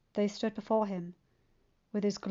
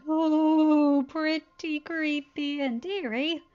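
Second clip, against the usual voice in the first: shuddering; 7 semitones higher, 5 dB louder, much slower than usual. A narrator's reading. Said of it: "Cheese shuddered".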